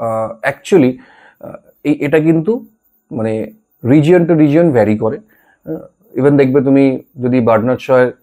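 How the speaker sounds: ordinary delivery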